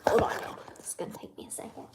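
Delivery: hushed whisper aside. Real, not acted. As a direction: whisper it so the pet stays calm. Whispering while handling pet.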